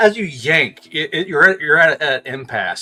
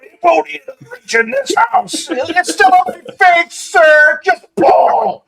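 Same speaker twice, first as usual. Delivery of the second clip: strained, high, loud yelling; hammed-up frantic older mechanic; older guy shouting; 6 dB louder, much slower than usual. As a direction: roar orders to the crew mid-struggle. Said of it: "you hear the older guy go".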